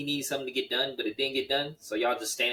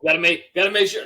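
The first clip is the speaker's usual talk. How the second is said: higher and louder mock panic; loud joking alarm; 5 semitones higher, 11 dB louder than usual. Real, not acted. Joking about his baby mama watching, loud mock panic. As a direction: blurt it out, pretending to panic.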